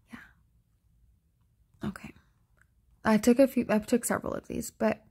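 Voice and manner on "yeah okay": whisper. Real, not acted. Whispered aside after laugh.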